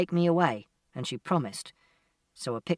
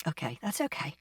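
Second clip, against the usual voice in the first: soft, hushed reassurance; subdued acceptance; 8 dB quieter than usual. A narrator's reading. Mother accepting her son's refusal, quietly. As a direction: back off gently, much softer.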